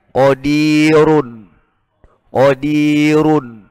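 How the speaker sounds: very slow qadirun with a thick r; heavy r in qadirun. Shown as a demonstration. Teacher drawing out qadirun with a heavy r.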